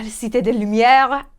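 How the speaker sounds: Cité de Lumière said slowly in French; French pronunciation. Shown as a demonstration. Naming Paris fondly, slow French pronunciation. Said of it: "City of Lights".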